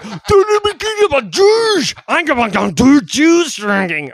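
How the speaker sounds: high, loud mock dictator shouting; hammed-up frenzied shouting; ranting Hitler impression. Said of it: "Hitler smoked a bunch of meth and was like".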